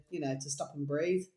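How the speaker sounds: normal talking voice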